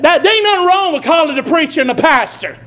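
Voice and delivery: usual speaking voice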